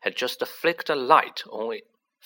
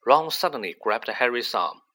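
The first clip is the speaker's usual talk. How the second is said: usual speaking voice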